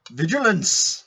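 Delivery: ringing mock-dramatic call; theatrical exclamation. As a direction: proclaim it as a grand warning. Climax of theatrical script line.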